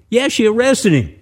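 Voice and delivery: high, excited insistence; emphatic confirmation. A narrator's reading. Storyteller stresses surprising arrest.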